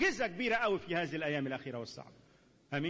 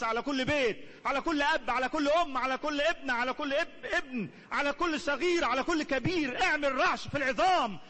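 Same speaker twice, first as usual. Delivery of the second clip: louder, higher, full-force preaching prayer; fervent loud prayer; 6 semitones higher, 7 dB louder than usual. Acted. Pastor leading congregation in prayer, fervent and loud. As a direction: cry out to God with rising urgency.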